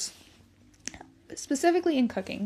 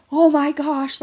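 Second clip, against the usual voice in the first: loud panicked gasp of alarm; panic mode; 5 semitones higher, 10 dB louder, slower than usual. Retold or imitated. Mom retelling her panic at her hurt toddler, loud. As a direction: blurt it in a sudden panic. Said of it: "like, panic mode".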